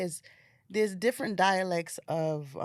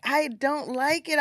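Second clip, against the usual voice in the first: mock complaint; 8 semitones higher, 6 dB louder, slower than usual. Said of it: "to me, I'm like".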